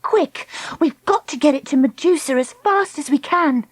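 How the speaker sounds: high, anxious command; urgent. Acted. Dakota rushing the turtle to Medusa, urgently.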